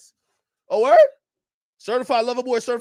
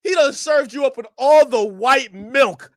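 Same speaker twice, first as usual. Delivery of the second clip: usual speaking voice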